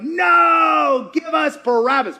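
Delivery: loud mob demand; crowd shouting. Retold or imitated. Crowd stirred up by priests, shouting for Barabbas. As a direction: yell it like an angry mob.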